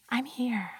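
whispered. Pretend.

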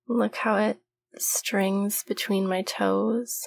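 ordinary delivery

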